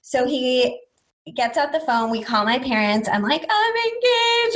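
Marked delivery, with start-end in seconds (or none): 3.5-4.6 very high, drawn-out mock wail; high-pitched retold cry of joy; imitated tearful announcement. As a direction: squeal the news slowly, imitating happy tears. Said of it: "I'm crying, all this stuff".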